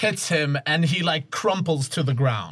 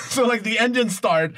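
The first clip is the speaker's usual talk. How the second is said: normal talking voice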